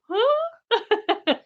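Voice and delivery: high squeaky put-on dog voice; dog's reply. Owner voicing her dog, a sudden high puzzled huh. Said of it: "He'll go".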